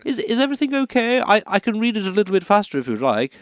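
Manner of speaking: nervous, rushed offer; flustered and hurried. Acted. Author interrupted by the producer, hurried and flustered. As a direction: hurry to please the producer.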